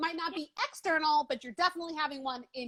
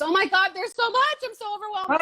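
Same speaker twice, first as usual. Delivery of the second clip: higher, louder, flustered overwhelmed quote; mock overwhelmed person; 7 semitones higher, 7 dB louder than usual. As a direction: play someone swamped and panicking. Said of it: "One person's gonna see that as".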